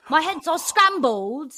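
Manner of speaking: loud, drawn-out mock British accent; British accent belted out slowly; bad British accent. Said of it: "Was my British accent that bad?".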